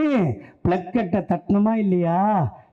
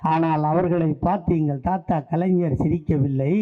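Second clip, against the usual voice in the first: ordinary delivery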